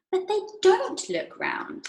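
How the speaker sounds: put-on high, slow, petulant girl voice; Veruca's voice. A spoilt girl arguing with Mister Wonka, high and slow. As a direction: pitch up into a spoilt child.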